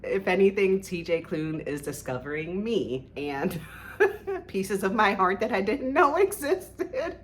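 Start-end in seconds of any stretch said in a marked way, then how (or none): 4.0-7.2 higher, giggling through it; giddy laughing confession. Real, not acted. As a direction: laugh as the feeling spills over. Laughs mid-phrase.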